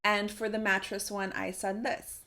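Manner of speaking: ordinary delivery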